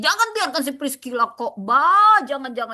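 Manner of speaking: high, hammed-up protest with loud bursts; mock forbidding outcry. Imitating those blocking Priscilla from preaching, exaggerated outcry.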